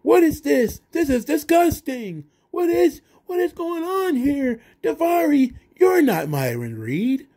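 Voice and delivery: high, whiny mock-outraged announcer; terrible commentator. Commentator scolding Daivari for wearing the vest, high mock outrage. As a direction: squeal it out like a bad wrestling commentator scolding Daivari. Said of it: "which led to the really terrible commentators".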